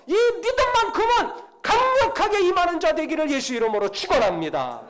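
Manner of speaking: loud, high preaching climax building to a falling close; full-force preaching. Real, not acted. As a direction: build to a ringing pulpit blessing.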